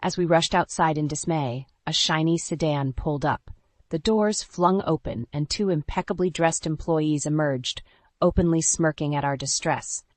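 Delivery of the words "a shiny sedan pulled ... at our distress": ordinary delivery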